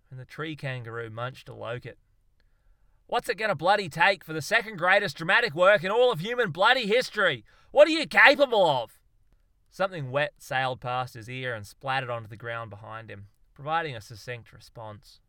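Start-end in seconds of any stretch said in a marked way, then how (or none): 3.1-9.0 loud, high desperate outcry; exasperated rant. Acted. Darwin demands a masterpiece from the monkeys, loud rant. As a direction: plead and rage at them.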